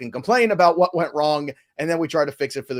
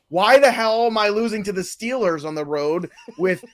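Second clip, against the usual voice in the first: lightly voiced annoyed quarterback; Tom Brady voice; 6 semitones higher, 3 dB louder than usual. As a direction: grumble like a losing veteran. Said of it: "That's what Tom Brady's saying to himself".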